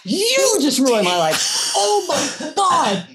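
milk the tragedy of a poked eye; mock tragic outrage. Actress wailing at hairdresser who poked her eye, hammed-up tragic. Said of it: "And I'm like".

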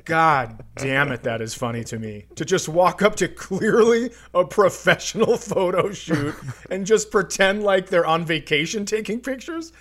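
usual speaking voice

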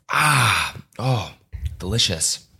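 just after a sip of coffee; savoring a hot coffee; while sipping a drink. Real, not acted. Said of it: "take a sip of my coffee".

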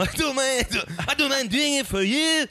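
high mock Mexican accent that trails off; a half-hearted Latin rocker voice; fading Mexican accent. Offering a favor in a failing mock Mexican accent. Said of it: "you can't do an accent".

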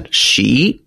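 "Xi" with a rising tone. Shown as a demonstration. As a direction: lift the pitch on "Xi". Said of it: "I think you've gotta go up".

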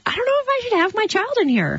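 fast, high worried quote; anxious inner voice. Retold or imitated. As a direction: rush it out, unsure and uneasy. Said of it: "You're like".

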